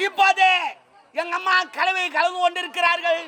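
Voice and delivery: ringing mock-formal announcement; mock live commentary. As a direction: proclaim the chore to a crowd.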